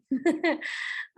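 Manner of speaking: amused chuckle after a joke; short, easy laugh; light laugh. Real, not acted. Joking about falling asleep.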